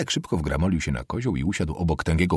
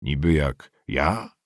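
low, slow sceptical question; sceptical mutter; 5 semitones lower, slower than usual. A narrator's reading. Peasant doubting the student's words, sceptical mutter. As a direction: mutter it slowly, full of doubt. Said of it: "mruknął sceptycznie".

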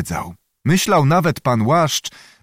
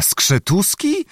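much higher, slow disbelieving question; astonished; 8 semitones higher, slower than usual. A narrator's reading. The prince hears his officer's name, astonished. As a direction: let the name rise in real shock. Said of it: "Prawdziwe zdumienie".

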